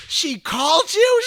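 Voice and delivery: very high, loud, slow imitated shriek; hammed-up mother shrieking in amazement; thrilled shock. Mother learning the Broadway star phoned her son, shrieking mock surprise. Mother's reaction to the news, acted.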